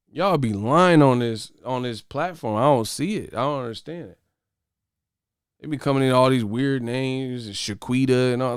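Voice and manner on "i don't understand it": normal talking voice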